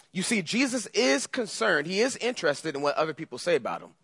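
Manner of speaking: usual speaking voice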